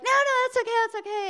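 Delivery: high, fast, breezy dismissal; friend brushing her off. Retold or imitated. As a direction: chirp it off high and quick, waving her away. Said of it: "And he was like".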